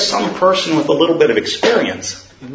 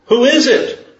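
much higher, startled question; panicked man; 11 semitones higher, 4 dB louder than usual. Acted. Man on the cliff asks who spoke, high and startled. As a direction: jump up in pitch, alarmed.

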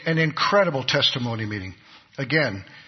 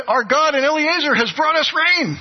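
loud, high triumphant cry; crowd shouting; 13 semitones higher, 7 dB louder than usual. Retold or imitated. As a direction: shout it out as the rain-soaked crowd. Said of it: "He says".

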